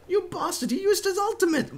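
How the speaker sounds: high, loud outraged cry; shocked shout. Real, not acted. Enemy ult surprise.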